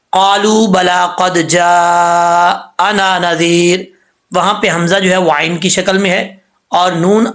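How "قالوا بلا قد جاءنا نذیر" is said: slow chanted recitation; Quran recitation. Shown as a demonstration. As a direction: recite it slowly like tilawat. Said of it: "جیسے سورہ ملک میں بتایا تھا،".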